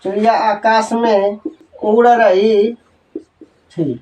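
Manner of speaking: slow, word by word, for pupils to write down; slow dictation. Shown as a demonstration. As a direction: dictate each phrase with pauses. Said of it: "लिखेंगे, चिड़िया". (धीमे-धीमे लिखवाते हुए)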